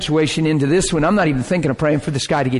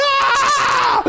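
overwhelmed scream. Retold or imitated. Pounding the steering wheel, he screams Ah in awe. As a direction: shout it out, overwhelmed. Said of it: "I was just screaming".

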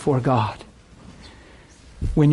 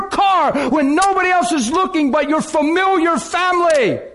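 passionate preaching shout; 8 semitones higher than usual. Retold or imitated. Preacher pleading with his home group, a passionate shout. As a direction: preach it hard like a fired-up pastor. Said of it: "I'm crying this out with passion".

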